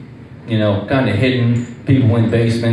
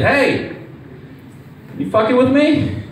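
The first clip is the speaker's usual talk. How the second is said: incredulous question; 10 semitones higher than usual. Retold or imitated. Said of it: "I said".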